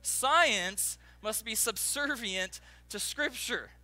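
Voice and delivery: slow, emphatic, each word set apart; careful, practised delivery. Shown as a demonstration. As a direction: land hard on SCIENCE, then measure out the rest. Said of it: "I've been practicing all week trying to say that".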